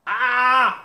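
high loud yelp of joy; real excited squeal; excited cry. Real, not acted. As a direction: let out a thrilled shout. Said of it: "I was just so excited".

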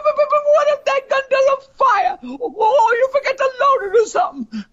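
very high, fast stammering alarm; frantic squeal. Acted. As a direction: sputter it out up high. Friend frantic about gun.

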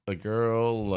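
ordinary talk, nothing marked

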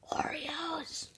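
breathy teaser whisper. Acted. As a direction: whisper it like a secret. Teasing the topic in a whisper.

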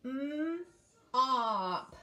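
segment mop slowly; sound-by-sound split. Shown as a demonstration. Teacher breaks the word into its sounds. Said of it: "Let's do all the sounds in mop".

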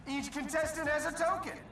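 ordinary delivery

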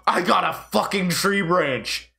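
slow, high, excited crow; thrilled exclamation. Real, not acted. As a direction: savour the find, stretched out high. Gasps on finding a branch.

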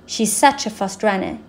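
plain reading voice

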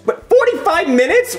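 very high, incredulous squawk; high-pitched astonishment; shocked outburst. Acted. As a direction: repeat it in rising disbelief. Shocked at the wait.

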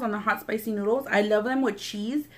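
normal talking voice

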